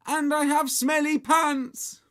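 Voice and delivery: slow, high, loud film line; screamed out. A narrator's reading. Henry demonstrates Peter's drowning line, screaming out. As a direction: cry it out like a drowning victim. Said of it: "scream out".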